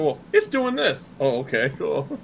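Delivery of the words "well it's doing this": put-on high, slow childlike voice; mimicking a kid customer. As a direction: play the kid who can't explain the problem. Kid describing a computer problem.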